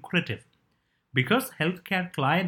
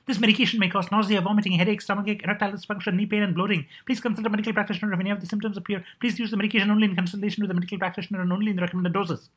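fast, higher ad disclaimer read; rushed legal disclaimer; 4 semitones higher, much faster than usual. Retold or imitated. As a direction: rattle off the side effects at top speed. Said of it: "The voiceover would simply rush through the second half".

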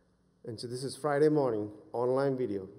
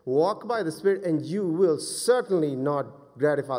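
louder, emphatic scripture quote; forceful preaching; 4 semitones higher, 8 dB louder than usual. Real, not acted. Preacher quoting the Spirit verse to church, forceful preaching. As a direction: proclaim it to the congregation.

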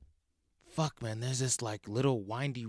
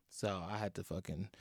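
ordinary delivery

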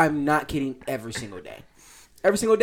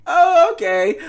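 high, loud startled okay; mock surprise; 13 semitones higher, 8 dB louder, much slower than usual. Imitating a startled reply to a stranger, high and loud. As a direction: sound caught off guard but polite. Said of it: "I would be like this".

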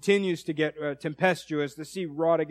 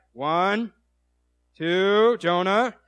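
warning countdown; 2 semitones higher, 5 dB louder, much slower than usual. Retold or imitated.

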